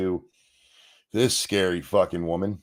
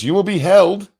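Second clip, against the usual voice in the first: mocking imitation; 9 semitones higher, 8 dB louder than usual. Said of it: "'cause she goes".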